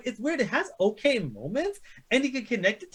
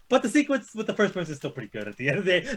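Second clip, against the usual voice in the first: ordinary talk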